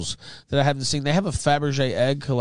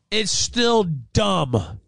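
very slow, drawn out, higher; stubborn slow repeat; 8 semitones higher, much slower than usual. Retold or imitated. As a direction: stretch every word out, stubbornly. Retold stubborn repeat to deputy.

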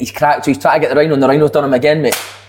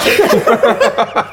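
burst of laughter; 6 semitones higher than usual. Real, not acted. Laughing at the quote.

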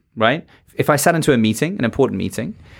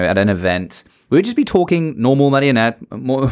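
ordinary delivery

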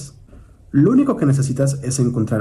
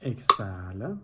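soft, slow counting of breaths, drawn-out rising ends; guided breath counting; 3 semitones lower, 11 dB quieter, slower than usual. Acted. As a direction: count the breath gently for a meditation. Marca inhalar y exhalar. (conteo de respiración suave y pausado)

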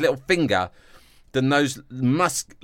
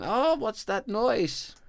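put-on high, slow frightened kid; mock trapped child, wary; high squeaky child asking; scared child voice; 11 semitones higher, 4 dB quieter, slower than usual.

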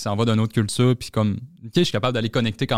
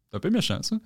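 high, softer mock protest; retold mild reproach; 7 semitones higher, 5 dB quieter than usual. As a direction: play a small, high-pitched objection. Her reaction to misplaced laughter, retold. (petit reproche joué, voix aiguë et douce)

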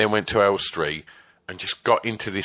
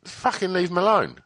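pitched way up, snapping at him; mock angry yell; 9 semitones higher than usual. Snapping at the manager to leave the singer alone, mock yell. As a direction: jump in to defend the singer. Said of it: "and then I was like".

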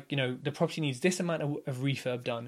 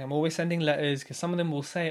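normal talking voice

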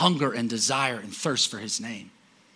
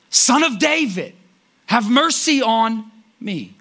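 much higher, louder, drawn-out plea; crying out louder; 9 semitones higher, 6 dB louder, slower than usual. Retold or imitated. Blind man cries out louder over the disciples, desperate. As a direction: cry out even louder, stretching it. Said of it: "he cried out all the louder".

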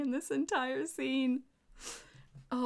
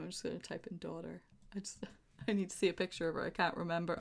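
real muttered whisper, much softer; whisper to herself; 6 semitones lower, 10 dB quieter, faster than usual. Real, not acted. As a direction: whisper while searching online. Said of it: "typing to herself, whispering".

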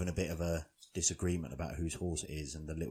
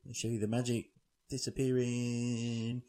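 slow, drawn-out showman build-up; higher, suspenseful drawl before the reveal; stage magician patter; 6 semitones higher, 4 dB louder, slower than usual. Retold or imitated. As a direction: present it like a hammy magician. Voices magician announcing his trick.

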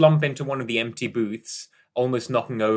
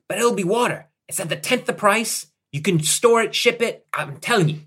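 higher, louder hard sell; excited sales pitch; 9 semitones higher, 5 dB louder than usual. Acted. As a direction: convince them it's the next big thing.

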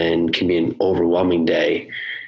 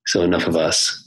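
ordinary talk

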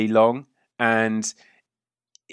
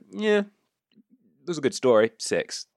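shrugging Gortusk; 3 semitones higher, slower than usual. Acted. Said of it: "Gortusk shrugs and goes".

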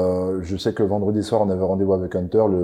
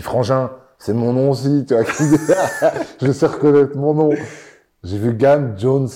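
laughing; 5 semitones higher, 5 dB louder than usual. Retold or imitated. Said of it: "Il dit".

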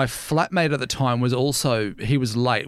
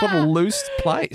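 ordinary talk

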